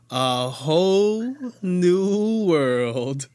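loud, drawn-out game show reveal; grand announcement. Acted. Host revealing the song to sing, grand announcer delivery. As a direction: announce it slowly and loudly, stretching every word.

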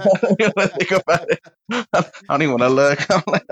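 giggling through the words; laughing. Real, not acted. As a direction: laugh while you talk it out. Grossed out by wet wipes.

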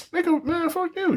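high, mocking squeal of a retort; voicing his best friend's comeback, high and mocking. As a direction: toss it back in a high squeaky voice. Friend's retorted insult voiced.

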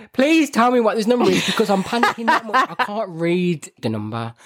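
high, rushed plea, half laughing; mock panic. Pleading with her husband while stuck on the radio call, mock panic. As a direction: beg in a comic panic. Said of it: "lost in this panic".

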